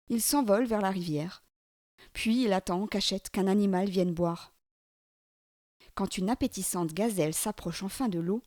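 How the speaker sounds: ordinary delivery, nothing marked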